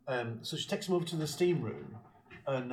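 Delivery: ordinary talk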